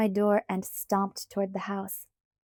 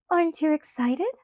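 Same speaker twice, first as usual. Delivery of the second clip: high, gleeful crowing question; 7 semitones higher, 3 dB louder than usual. A narrator's reading. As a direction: crow it with delight. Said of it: "she crowed".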